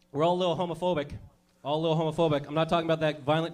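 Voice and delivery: ordinary talk, nothing marked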